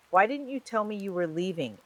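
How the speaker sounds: wounded worry under the panic; hurt and panicked. A narrator's reading. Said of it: "there was hurt beneath the panic".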